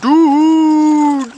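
dude called out high and loud, over and over; excited repeated calls. Real, not acted. Spotting something exciting, calling dude high and loud.

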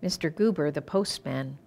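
ordinary delivery

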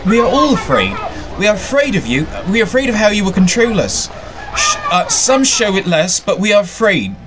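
put-on timid servile girl, high and shaky with fear; nervous quavering servile. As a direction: stammer it out like a scared little servant. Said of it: "She says in a quavering voice".

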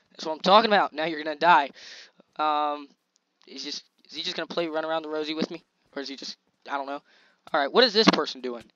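ordinary talk, nothing marked

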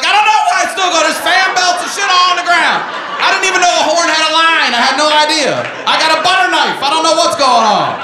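high, loud frantic yelling; hysterical, helpless hollering; panicked shouting. Acted. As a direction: shout like you are hopelessly out of your depth. Acts himself failing to fix horn.

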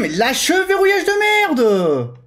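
frustrated yelling. Real, not acted. Cursing at game lock-on.

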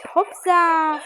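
excited jump cry; excited hopsa. Real, not acted. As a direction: cheer Hopsa as the jump lands. Jumping in a game, calling out.

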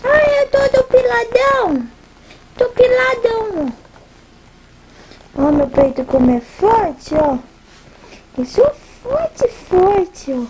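playful pretend baby voice; baby's voice. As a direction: speak for the baby in playful baby talk. Mother voices her baby.

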